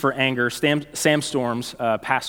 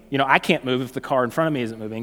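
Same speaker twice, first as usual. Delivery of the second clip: ordinary delivery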